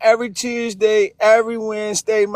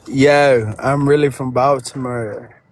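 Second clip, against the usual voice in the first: put-on heavy Baltimore street accent, low; thick Baltimore accent; 9 semitones lower, slower than usual. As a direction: lean hard into a Baltimore drawl. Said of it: "I hate that accent".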